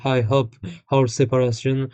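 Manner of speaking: English with a thick French accent; halting French-accented English; bad English accent. Real, not acted. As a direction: read English clumsily with French sounds. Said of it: "mon accent anglais pue la merde".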